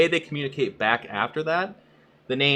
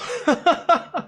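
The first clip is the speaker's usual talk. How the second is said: high laugh; 5 dB louder than usual. Real, not acted. Laugh after the quote.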